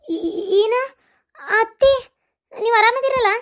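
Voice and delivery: high-pitched, taken-aback questioning; stammer, then ask in surprise; surprised. Acted. Daughter-in-law to mother-in-law over offered gold, surprised.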